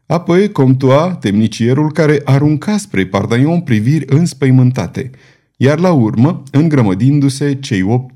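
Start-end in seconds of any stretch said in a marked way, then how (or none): none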